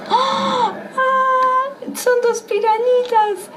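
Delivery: high, excited squeals of discovery; surprised squeal. Acted. Surprised guessing piranhas.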